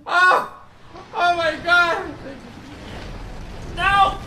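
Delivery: shocked shouts in short bursts; dismayed yelling. Real, not acted. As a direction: cry out as you take hits. Being hit in the game.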